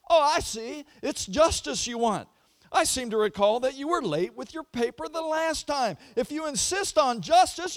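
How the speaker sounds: high, loud, building to a shout; indignant professor. Retold or imitated. Professor punishing a student who demanded justice, high and loud. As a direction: pour out the verdict, rising to a shout.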